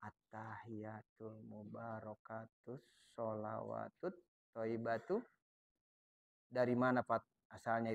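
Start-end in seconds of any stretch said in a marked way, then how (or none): 0.1-5.2 low, slow, quiet chant; soft tahiyat recitation. Shown as a demonstration. Teacher showing the tashahhud recited softly. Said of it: "Mesti kedengeran".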